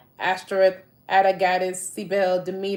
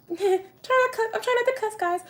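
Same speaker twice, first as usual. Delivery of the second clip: much higher, giggling apology; high-pitched laughing catch at own cussing; laughing squeal; 13 semitones higher than usual. Real, not acted. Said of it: "I'm sorry".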